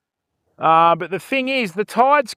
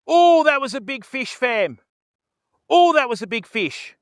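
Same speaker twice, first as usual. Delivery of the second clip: higher, eager exclamation over a lost fish; excited; 4 semitones higher than usual. Real, not acted. Streamer telling chat about a lost fish, excited. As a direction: blurt it out, thrilled and disappointed. Missed a big fish strike.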